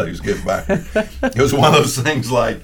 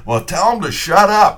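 higher, louder, irritated demand; angry order; 10 semitones higher, 6 dB louder than usual. Retold or imitated. Upset coach orders his radio man to silence the announcer, retold angry order.

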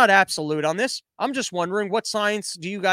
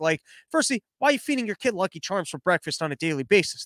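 ordinary delivery

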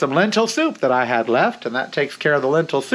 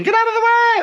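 mock scream. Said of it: "I hear people screaming".

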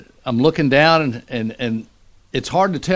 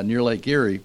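ordinary talk

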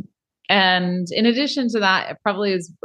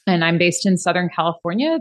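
ordinary talk

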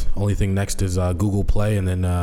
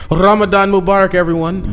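loud greeting; 12 semitones higher, 8 dB louder than usual. Acted.

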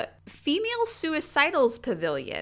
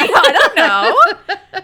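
laughing; 10 semitones higher, 14 dB louder, much slower than usual. Real, not acted.

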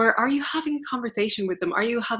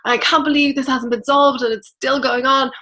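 louder, higher exasperated rant; a half-acted frustrated outburst; mock rant; 4 semitones higher, 6 dB louder than usual. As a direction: vent it like an impatient rant at a friend. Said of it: "start ranting and saying".

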